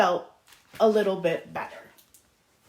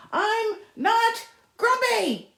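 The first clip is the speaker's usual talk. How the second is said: storybook shout; 11 semitones higher, much slower than usual. Acted. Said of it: "Jim shouted as he beat his chest".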